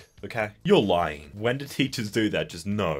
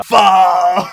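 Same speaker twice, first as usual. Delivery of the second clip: much higher and louder, a stretched yell; loud cry of far; 9 semitones higher, 15 dB louder than usual. Shown as a demonstration. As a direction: belt out the word Far! as a wild shriek. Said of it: "how is that vocalized?".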